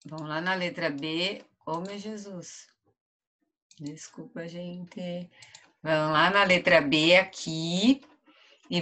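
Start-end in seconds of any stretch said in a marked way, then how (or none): 1.6-5.3 much softer, muttered apology; quiet aside. Real, not acted. Teacher apologises to pupils after a slip, quiet aside. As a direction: drop the voice right down for an apology.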